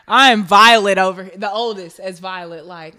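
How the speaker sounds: ordinary talk, nothing marked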